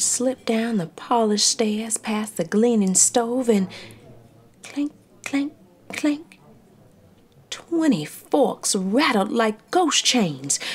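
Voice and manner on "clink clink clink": high, delicate clink; clinking sound. A narrator's reading. Narrator imitating forks clinking, light. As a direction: tap the words like forks.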